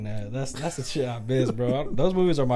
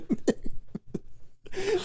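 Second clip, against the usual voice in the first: airy high laugh; breathy high laugh; 4 dB quieter than usual. Real, not acted. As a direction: wheeze of laughter. Laughing at own joke.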